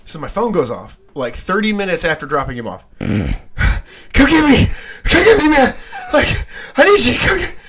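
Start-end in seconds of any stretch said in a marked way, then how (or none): 3.0-7.6 high, frantic yells of a scared friend; frantic plea for a ride, high and loud; panicked yelling. Retold or imitated. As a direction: shout it like you're running for your life. Friend's panicked call retold.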